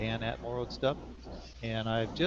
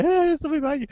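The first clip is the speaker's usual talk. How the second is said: high, loud pretend warning; mock alarm; 9 dB louder, much faster than usual. Viewer warning the host of someone behind him, mock alarm. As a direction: call it out high, like a startled onlooker.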